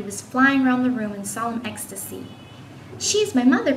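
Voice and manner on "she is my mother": higher-pitched, lightly acted exclamation; exclaiming. A narrator's reading. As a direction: exclaim it as a boy's announcement. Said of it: "Peter excla-, exclaimed".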